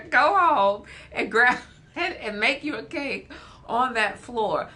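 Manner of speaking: laughing through the words. Real, not acted. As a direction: chuckle as you urge them on. Laughing while urging the kid's cake.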